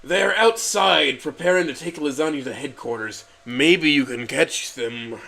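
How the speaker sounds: slow, loud councilman; a councilman, slowly telling the truth. A narrator's reading.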